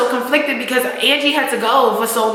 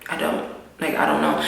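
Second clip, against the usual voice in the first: soft, lower, breathy denial; hushed denial; 7 dB quieter than usual. Retold or imitated. Ghost lying to his wife, murmured low and soft. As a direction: barely voice the dodge.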